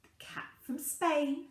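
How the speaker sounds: usual speaking voice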